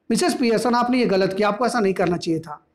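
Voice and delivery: ordinary delivery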